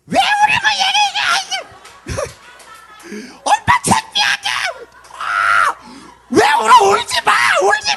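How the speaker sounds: high, loud mock-furious shrieking; mother scolding while hitting. Embarrassed mother hitting her crying child, mock furious shriek. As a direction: scream at the child to stop crying, hammed up. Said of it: "때리면서 울지 말래".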